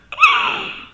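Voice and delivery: sharp high stagey gasp; high mock gasp. Fan imitating characters, high mock gasp. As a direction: gasp like a shocked cartoon. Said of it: "the characters going".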